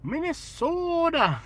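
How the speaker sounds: high Minnesota accent with a long drawn O. Shown as a demonstration. Trying out an impersonation, drawn-out Minnesota accent. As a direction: stretch it out in mock Minnesotan. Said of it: "I gotta learn how to make a Minnesota impersonation".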